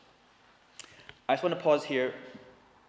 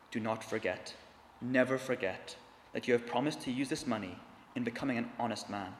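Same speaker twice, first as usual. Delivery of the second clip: softer, low, earnest reminder; 4 semitones lower, 6 dB quieter than usual. Acted. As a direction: say it close and hushed, like a charge. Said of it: "said in a low voice".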